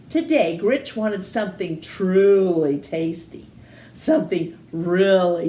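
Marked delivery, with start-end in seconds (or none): none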